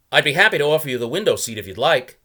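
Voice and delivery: lightly voiced young traveller; polite young man making small talk; Mason voiced. A narrator's reading. Mason's voice in read story.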